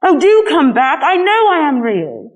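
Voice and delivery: pleading. A narrator's reading. Pleading after them.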